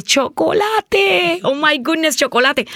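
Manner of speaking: slow, drawn-out gasp of delight at chocolate; excited discovery. Retold or imitated. A hungry girl spotting chocolate, slow and delighted. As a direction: savor the word like a starving kid finding candy.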